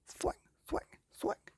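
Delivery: whispered slapping thwacks; soft smacking sound effect; hushed thwack noises. A narrator's reading. Wings hitting face effect.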